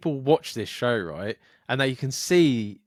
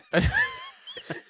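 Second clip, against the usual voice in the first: high shrieking laugh. Real, not acted. Laughing at TV clip.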